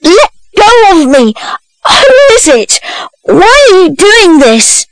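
put-on high-pitched boyish protest; struggling cry. A narrator's reading. Boy grabbed by an unknown woman, high struggling protest. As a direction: plead in high alarm, fighting to get free. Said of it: "cried Oliver, struggling".

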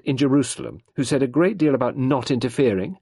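high, loud accusing challenge; heated, pointed reproach; demanding. A narrator's reading. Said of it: "Sarah demanded".

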